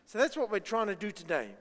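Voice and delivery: ordinary talk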